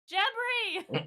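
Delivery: high, loud name call. Acted. Player calling the footman Jebri over, hollering. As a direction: holler to summon a servant.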